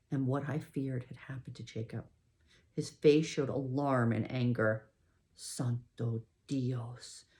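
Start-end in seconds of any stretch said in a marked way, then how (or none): 5.3-7.2 low, slow, much softer mutter; shocked mutter. A narrator's reading. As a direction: mutter it in dismay. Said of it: "he muttered".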